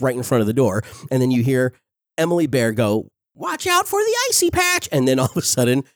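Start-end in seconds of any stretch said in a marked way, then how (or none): 3.3-4.9 put-on high, sweet little-girl warning. Quoting [name]'s line.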